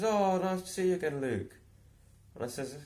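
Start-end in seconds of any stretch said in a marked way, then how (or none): 0.0-1.5 high, loud mimicry of Steve; lightly mocking a chatty stranger; imitating Steve. Mimicking Steve greeting Luke in the shop, high and loud.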